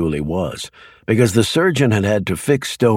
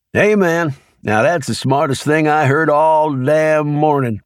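Leroy, easygoing country man; 7 semitones higher than usual. A narrator's reading. Leroy telling FBI agents his daily routine, folksy and unbothered. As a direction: play it easy and homespun, nothing to hide.

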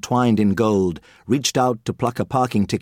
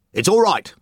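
snapping; 8 semitones higher than usual. Acted. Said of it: "Gordon snapped".

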